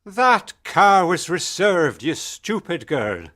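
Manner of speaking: angry shout. A narrator's reading. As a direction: berate her for a blunder. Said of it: "he shouted".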